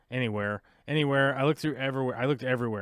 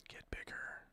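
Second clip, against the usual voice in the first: whispered plea; 21 dB quieter than usual. Real, not acted. Pleading with window on screen.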